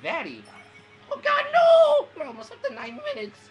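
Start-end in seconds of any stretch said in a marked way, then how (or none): 1.1-2.1 high, very loud wail; panicked gamer shriek; dismayed scream. Real, not acted. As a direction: cry out in horror at losing. Dismay in game.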